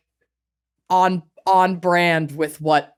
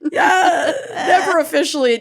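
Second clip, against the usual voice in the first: high laughing yelp; 9 semitones higher than usual. Real, not acted. Laughing answer.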